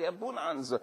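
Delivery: normal talking voice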